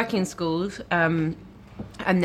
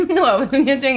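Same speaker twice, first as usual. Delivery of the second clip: laughing loud, pitch up; 7 semitones higher, 8 dB louder than usual. Real, not acted. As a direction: burst out laughing on No. Laughing at his voice.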